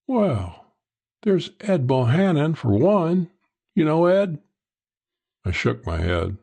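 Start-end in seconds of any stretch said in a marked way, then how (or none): 0.1-4.4 higher-pitched woman's character voice; coy woman informant. Acted. Woman feeling out a stranger about Ed, coy.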